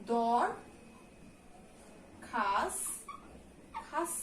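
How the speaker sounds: দরখাস্ত broken into দর and খাস, with pauses between; দর, then খাস, said apart with a dental s; syllable by syllable. Shown as a demonstration. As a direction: split দরখাস্ত slowly into dor, khash. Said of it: "স এর উচ্চারণটা ছয়ের মতো হচ্ছে".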